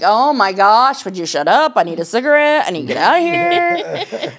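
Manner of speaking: hammed-up impatient outburst with a laugh; exasperated outburst. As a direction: let it burst out, laughing at yourself. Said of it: "I'm like".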